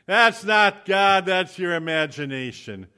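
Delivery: loud, high, drawn-out rebuke; hammed-up exasperated shout, stretching every word; aggravated retort. Aggravated passenger overruling the driver's word from God, exasperated shout. Acts out his aggravated objection.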